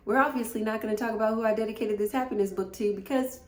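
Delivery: high, rushed, tearful brush-off; trying not to cry. Real, not acted. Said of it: "don't wanna be crying".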